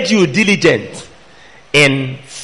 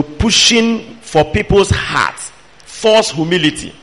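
ordinary talk

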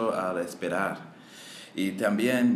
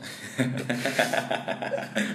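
laughing; 2 semitones higher than usual. Real, not acted. Student laughs at supositorios.

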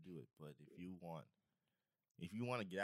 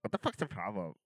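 imitating the girlfriend; 12 dB louder than usual. Man imitating Charles's girlfriend scolding him, loud and mocking. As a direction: ham up an angry girlfriend yelling at Charles. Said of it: "She's like".

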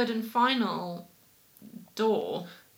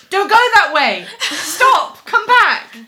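much higher and louder, a frantic warning yell; worried shouting; 10 semitones higher, 14 dB louder, slower than usual. Retold or imitated. Man warning her away from the seagulls, frantic shout. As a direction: call her back from danger, urgently. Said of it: "he sounds very worried".

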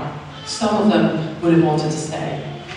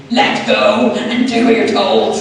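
louder and slow, a half-laughing mock command; stern order; 4 semitones higher, 5 dB louder, slower than usual. God finally telling her firmly to obey, a mock stern command. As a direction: lay down the law with a grin. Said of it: "he had to say".

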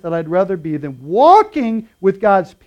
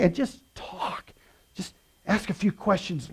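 whisper; 8 dB quieter, slower than usual. Real, not acted. Soft advice aside.

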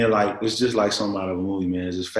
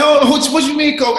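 much higher, louder shocked yelp; shocked what; 10 dB louder than usual. Retold or imitated. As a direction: blurt it out in disbelief. Disbelief at cocaine news.

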